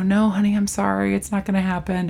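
soft, soothing letdown; gentle refusal. Retold or imitated. Telling Phoebe she can't keep one, gentle. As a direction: let them down gently.

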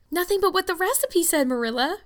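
high-pitched anguished protest; anguished cry. A narrator's reading. Anne defends her cake to Marilla, crying out in anguish. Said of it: "cried Anne with a look of anguish".

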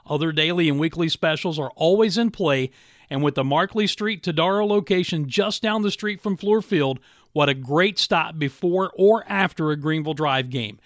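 ad read. Acted. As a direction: sell it like a radio spot. Host reads live radio ad.

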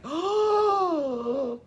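long, high wail of distress; hammed-up hysterical moan; mock wail. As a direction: let out a drawn-out high "oh". Imitates own reaction to film's end.

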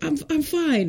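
high-pitched, stammered imitation of fighting tears; holding back tears. Hurt child to driver, imitating held-back tears. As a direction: act out pretending to be fine while nearly crying. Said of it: "trying not to cry".

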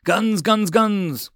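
loud, high-pitched combat call; yelling. A narrator's reading. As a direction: pilot calling out as he fires. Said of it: "Lagos yelled".

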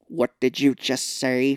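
slow, loud mock Australian accent at normal pitch; deliberate second go at the Australian accent; bad English-Australian accent. Redoing his shocked question, bad English-Australian accent. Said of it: "My English Australian?".

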